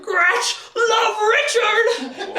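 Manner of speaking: a simple beast pouring out its love; gargoyle voice. Retold or imitated. Gratch greeting Richard again, high slow creature cry. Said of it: "Gratch is like".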